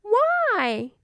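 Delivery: put-on high little girl asking why; cartoon princess. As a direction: ask like a curious little princess. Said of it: "said the little princess".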